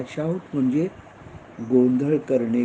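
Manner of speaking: normal talking voice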